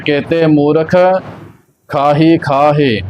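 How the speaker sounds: ਕੇਤੇ ਮੂਰਖ together, then a long break; pause after ਮੂਰਖ. Shown as a demonstration. Teacher showing where to rest, pause after ਮੂਰਖ. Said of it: "ਮੂਰਖ ਤੇ ਵਿਸ਼ਰਾਮ ਲੱਗਣਾ ਹੈ".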